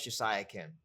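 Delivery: ordinary delivery, nothing marked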